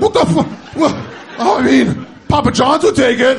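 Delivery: very high, loud mock outrage; hammed-up indignant squawking, breaking off mid-phrase; outraged sputter. Customer arguing over an expired coupon, hammed-up outrage.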